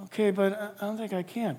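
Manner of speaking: shy objection, barely said; timid reply. Pretend. Timid believer answers the Spirit.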